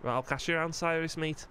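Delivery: put-on light Scouse accent as the character Joel; easygoing Liverpool lad in a Scouse voice. Joel chatting with Cyrus, relaxed Scouse accent.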